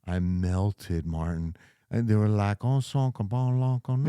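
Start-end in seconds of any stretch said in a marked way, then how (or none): 2.7-4.1 put-on slow, drawn-out Cajun girl talk; Cajun accent. Telling host how Cajun girls talked, in mock Cajun. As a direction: imitate a Cajun accent, slowly. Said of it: "heard those Cajun girls talk".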